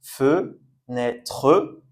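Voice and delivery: FENÊTRE split as "feu, nè, treu"; syllable by syllable. Shown as a demonstration. Teacher showing E without an accent, "fenêtre" in syllables. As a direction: sound out "fenêtre", each E distinct. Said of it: "ça se prononce E".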